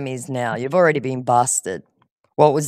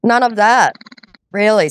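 higher, louder disbelieving echo; incredulous; 5 semitones higher, 4 dB louder than usual. Real, not acted. Woman challenging a scammer's denial, incredulous. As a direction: throw it back in disbelief.

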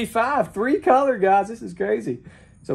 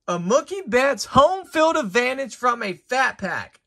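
usual speaking voice

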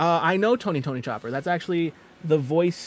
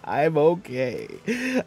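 put-on doubtful, stretched-out delivery; mock faltering reassurance; much slower than usual. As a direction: drag it out, less convincingly. Second, less sure mock reassurance.

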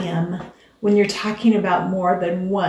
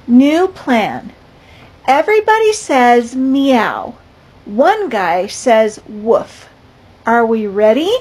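put-on lively, louder storybook reading for kids; picture book read-aloud; 3 semitones higher, 7 dB louder than usual. A narrator's reading. Teacher reading a picture book to her class, lively.

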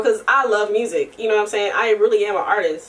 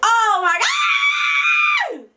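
much higher, drawn-out gushing cry; excited squeal; much slower than usual. Real, not acted. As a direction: squeal over something adorable. Gushing over cute baby.

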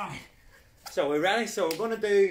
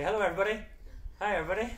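cheerful greeting; 6 semitones higher, much faster than usual. Shown as a demonstration. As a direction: greet the group with upbeat energy. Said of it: "We'll say".

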